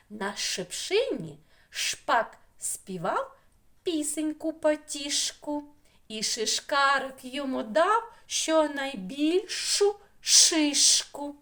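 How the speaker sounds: slow, high, measured verse with clear beats; children's rhyme recital. Shown as a demonstration. Teacher reciting rhyme about two birds, slow emphatic verse. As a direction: recite line by line, leaning on each "ш".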